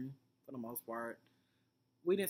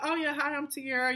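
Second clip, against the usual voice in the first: higher, sugary greeting; mock her fake friendly hello, pitched up; imitating Tierra; 10 semitones higher, 5 dB louder than usual. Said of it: "she's like".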